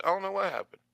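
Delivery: high-pitched plea of ignorance; acted-out know-nothing answer; retold denial. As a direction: play innocent under questioning, voice lifted high. Retells denying knowledge to police.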